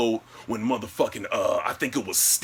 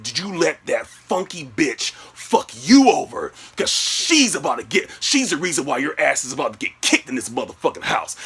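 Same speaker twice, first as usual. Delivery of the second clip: angry scolding; 3 semitones higher, 3 dB louder than usual. Retold or imitated. Boyfriend blaming girlfriend's friend, angry scolding. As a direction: tear into her, acted fury.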